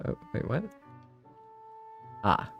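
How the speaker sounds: ordinary talk, nothing marked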